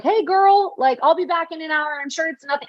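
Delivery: high, sing-song voice to the dog; sweet talk the French bulldog. Retold or imitated. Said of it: "I was like".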